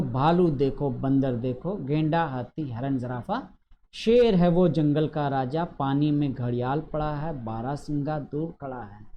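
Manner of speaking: lilting rhyme reading, falling line ends; poem read aloud. Shown as a demonstration. Teacher reading the zoo poem to pupils, lilting.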